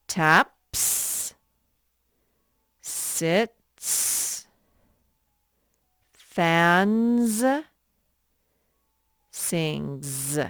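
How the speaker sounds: "Taps, sits, fans, sings" slowly, each ending held; sounded-out word endings. Shown as a demonstration. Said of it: "Let's read these words one more time".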